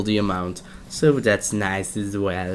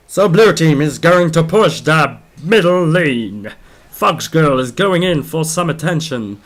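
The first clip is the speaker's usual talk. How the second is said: hyped-up tournament caster read; esports play-by-play; 4 semitones higher, 4 dB louder than usual. Acted. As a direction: call the fight like a live caster. Caster narrates the team fight.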